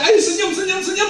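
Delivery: high, lively prompting; mock eager cheerleading for a photo; photographer's urging. Acting someone urging a photo smile.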